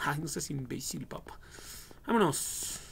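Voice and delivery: usual speaking voice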